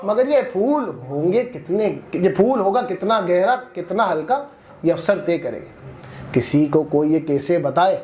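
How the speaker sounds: declaimed verse, lifting on repeated lines; poetry recitation. Acted. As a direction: declaim it to an audience, pausing between phrases. Recites Javed Akhtar's poem.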